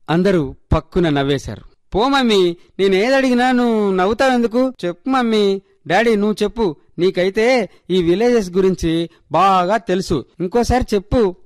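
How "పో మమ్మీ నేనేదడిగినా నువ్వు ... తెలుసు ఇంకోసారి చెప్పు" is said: put-on whiny little girl. A narrator's reading. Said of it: "అడిగింది సన్నీ".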